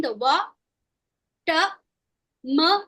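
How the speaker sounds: wa, ta, ma spoken as separate syllables with pauses; wa ta ma, each syllable said alone. Shown as a demonstration. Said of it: "these four sounds".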